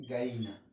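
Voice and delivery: "gallina." as "gaína", ll dropped; Lima pronunciation. Shown as a demonstration. Teacher writing up and voicing the Lima sound. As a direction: say "gallina." limeño style, without the ll.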